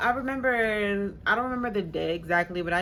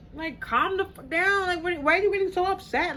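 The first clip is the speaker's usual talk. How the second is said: exasperated calm down; 11 semitones higher, 3 dB louder than usual. Retold or imitated. To the angry roommate, high exasperated calm the fuck down. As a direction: pitch up, incredulous and fed up.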